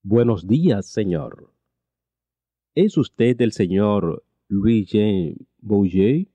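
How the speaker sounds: ordinary talk, nothing marked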